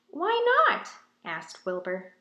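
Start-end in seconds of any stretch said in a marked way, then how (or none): 0.1-0.9 high, loud innocent "why not?"; innocent question. Acted. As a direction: ask it like a curious kid. Wilbur asks innocently.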